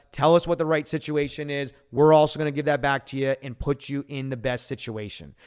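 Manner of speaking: normal talking voice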